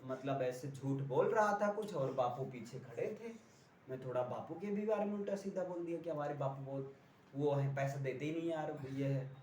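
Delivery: a hint of a sulky friend telling his woes; complaining friend. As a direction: grumble like a pal whose father scolded him. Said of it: "कोई आपसे अपनी problem बता रहा है".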